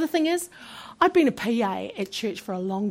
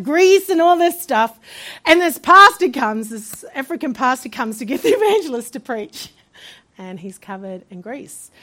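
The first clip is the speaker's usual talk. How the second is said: usual speaking voice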